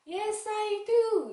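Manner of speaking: very high, very slow sing-song reply; puppet child answer. Pretend. Child puppet answering teacher's question, high and drawn out. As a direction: drawl it out high like a little kid.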